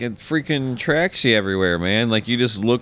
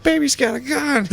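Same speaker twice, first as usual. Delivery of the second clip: very high squeaky mock line; mock cartoon voice. Friend retells the party scene, very high mock voice. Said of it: "He's like".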